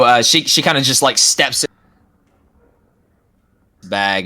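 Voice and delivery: usual speaking voice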